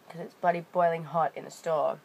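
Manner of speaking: normal talking voice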